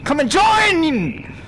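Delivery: drawn-out, high, loud yell; a long, high, loud call. Real, not acted. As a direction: stretch the invite into a long shout. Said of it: "Why am I yelling?".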